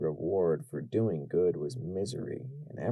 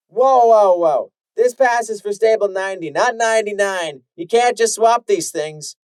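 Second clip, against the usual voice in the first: loud, higher, forceful refusal; stern guard; 12 semitones higher, 14 dB louder than usual. Acted. Stable guard refusing the mother's pass, loud and stern. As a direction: bark the rules at her.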